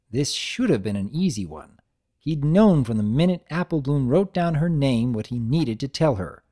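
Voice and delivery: plain reading voice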